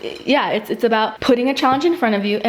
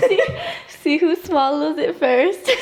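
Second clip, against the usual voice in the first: slow, laughing, higher; 6 semitones higher, slower than usual. Real, not acted. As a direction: draw it out while giggling.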